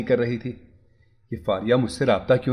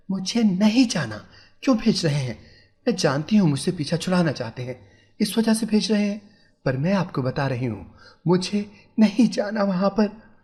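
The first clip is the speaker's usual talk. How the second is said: tearful frantic pleading; 6 semitones higher than usual. A narrator's reading. Wife cries, begging husband not to send her home. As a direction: plead through tears, frantic. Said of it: "वो रोती हुई पागलों की तरह कहने लगी".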